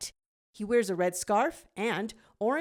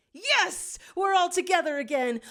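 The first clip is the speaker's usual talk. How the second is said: overjoyed cheer; 10 semitones higher, 6 dB louder than usual. Acted. Reunion with jackal sister.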